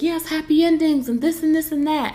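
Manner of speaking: high lilting mock of a naive romantic; mocking dreamy woman. Vlogger mimicking the woman, hammed-up dreamy voice. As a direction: squeal it like a starry-eyed fan. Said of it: "she was just like".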